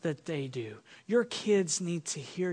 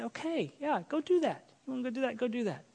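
soft, high-pitched easygoing permission; acted parent's light, quiet okay; relaxed parent; 5 semitones higher, 7 dB quieter, faster than usual. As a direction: give in gently, as a relaxed parent. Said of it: "and relax and just be real and say".

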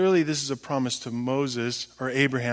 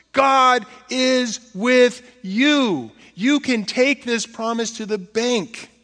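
full-force preaching; 9 semitones higher, 6 dB louder than usual. Acted. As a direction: hammer home the good news.

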